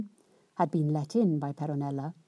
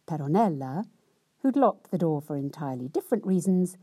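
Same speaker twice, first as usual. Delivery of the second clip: ordinary delivery, nothing marked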